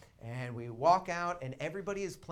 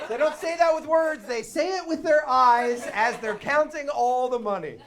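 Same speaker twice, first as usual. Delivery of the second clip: laughing; 9 semitones higher, 10 dB louder than usual. Real, not acted. Punchline, laughing delivery.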